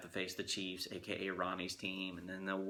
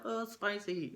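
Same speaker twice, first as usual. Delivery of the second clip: put-on much higher kid voice; imitating kids; 12 semitones higher than usual. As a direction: squeak it like little kids complaining. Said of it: "they all were like".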